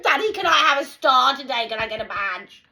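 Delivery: put-on high loud whiny child; mock child voice. Mocking a graduate as a child begging daddy, high and whiny.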